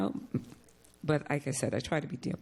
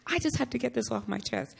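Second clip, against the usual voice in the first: higher, pent-up imitation of unloading; acted venting; 9 semitones higher than usual. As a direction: vent it to a friend, pitched up. Venting on phone to a friend.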